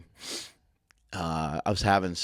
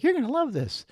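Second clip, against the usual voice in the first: much higher, coaxing put-on voice; mock coach voice. Coach pitching an exercise to a visitor, high mock voice. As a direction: sell the new exercise like a grinning trainer. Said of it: "He's like".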